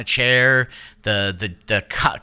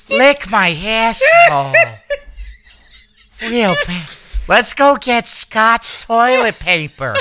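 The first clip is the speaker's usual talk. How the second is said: louder sultry diva drawl; Coolidge impression; 7 semitones higher, 4 dB louder, slower than usual. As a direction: purr it like the diva. Keeps doing the impression.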